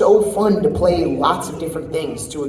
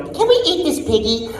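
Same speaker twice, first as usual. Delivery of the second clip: higher-pitched cartoon character voice; Seven Deadly Sins character; 6 semitones higher than usual. Shown as a demonstration. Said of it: "go to the next studio and go".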